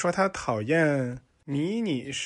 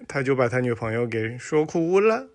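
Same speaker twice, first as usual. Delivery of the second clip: ordinary delivery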